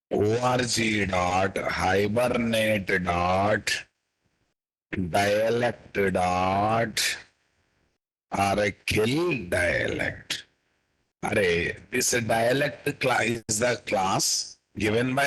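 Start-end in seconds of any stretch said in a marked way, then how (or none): 0.1-10.4 very slow, piece by piece; slow dictation. Shown as a demonstration. Teacher dictating the dialect class name, very slow. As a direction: dictate each part so students can type. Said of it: "teacher dictates class name slowly".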